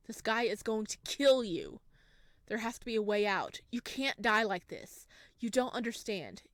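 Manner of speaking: ordinary talk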